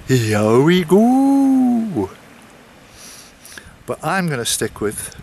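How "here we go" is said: put-on slow, drawn-out Welsh accent, high; Ralph's Welsh accent. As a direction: stretch it out in a sing-song Welsh accent. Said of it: "he would say in his Welsh accent".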